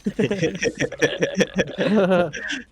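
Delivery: long, low chuckling laugh; amused laugh. Real, not acted. Streamer cracking up at friends' teasing banter, long amused laugh. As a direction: laugh it out in a steady run of chuckles.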